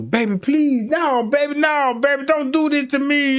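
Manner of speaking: high, loud mock pleading; a boyfriend begging his girlfriend not to press charges. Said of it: "guess what he finna do".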